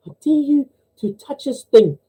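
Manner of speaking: high-pitched, teasing nun voice; daring joke nun. Acted. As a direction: dare them in a put-on high voice. Acting a nun daring another.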